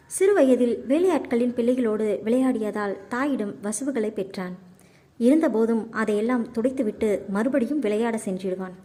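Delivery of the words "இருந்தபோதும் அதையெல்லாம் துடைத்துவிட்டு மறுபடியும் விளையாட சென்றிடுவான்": ordinary delivery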